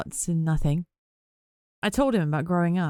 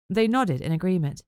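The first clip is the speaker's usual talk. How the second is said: ordinary delivery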